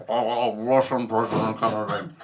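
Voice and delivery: gravelly politician impression; gravel-throated LBJ. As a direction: talk through a throat full of gravel. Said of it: "He had so much gravel in his throat".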